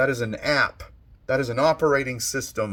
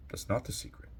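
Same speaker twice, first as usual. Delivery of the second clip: soft master's reply; 12 dB quieter, faster than usual. Acted. Master answers the student.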